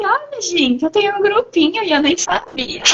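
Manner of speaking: high, delighted exclamation; excited discovery. Retold or imitated. Poet retelling how she found her poetry group, excited and high. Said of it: "Eu disse".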